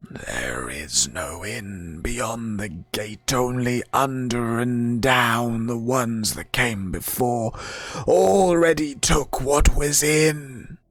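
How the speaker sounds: slow, whispered spirit voice; rasping undead whisper. Acted. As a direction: hiss it like an ancient guardian. Door spirit speaks.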